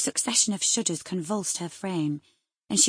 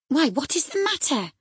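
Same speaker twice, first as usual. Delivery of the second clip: higher and louder startled call; alarmed cry; 8 semitones higher, 6 dB louder than usual. Acted. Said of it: "cried I".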